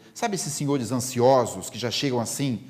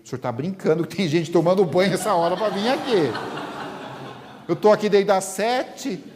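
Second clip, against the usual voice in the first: louder, higher scoffing laugh through the words; incredulous laughing man; 5 semitones higher, 4 dB louder than usual. Acted. Impatient man scoffing at Divaldo, laughing loudly in disbelief.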